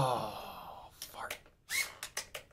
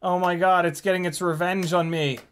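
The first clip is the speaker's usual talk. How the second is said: ordinary talk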